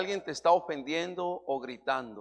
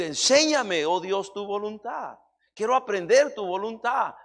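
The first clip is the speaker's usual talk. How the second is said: louder, impassioned preacher's voice, driving each phrase home; preaching at full force; 4 semitones higher, 7 dB louder than usual. Acted. Pastor urges listeners to know God's will, preaching loudly. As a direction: preach it hard to the congregation.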